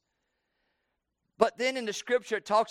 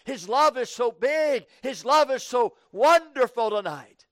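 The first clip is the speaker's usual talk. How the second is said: louder, rising preacher's run; preaching at full force; 4 semitones higher, 4 dB louder than usual. Acted. Preacher building up about Christ's love, louder and rising. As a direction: build it up to the congregation.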